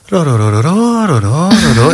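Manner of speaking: rolling "ro-ro-ro" swooping up and down; imitated searching noise. Showing co-hosts how Chat searched online, imitated searching noise. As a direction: mimic a machine humming through its search. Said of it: "Έκα-, έκανε έτσι".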